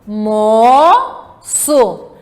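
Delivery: "Almoço" very slow, in three held syllables. Shown as a demonstration. Teacher sounding out "Almoço" for pupils, syllable by syllable. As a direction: stretch and separate each syllable of "Almoço". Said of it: "Vamos lá soletrar".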